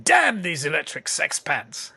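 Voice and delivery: slow, drawn-out hammy actor delivery; Matt Berry. Acted. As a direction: savour each word theatrically. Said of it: "I like it when he goes".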